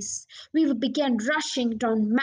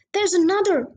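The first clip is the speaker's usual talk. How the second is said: excited discovery; 6 semitones higher, 7 dB louder than usual. A narrator's reading. Boy spots another falling bird.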